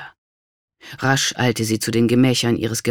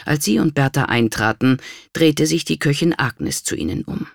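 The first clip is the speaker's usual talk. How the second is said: ordinary delivery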